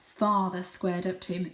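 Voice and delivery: usual speaking voice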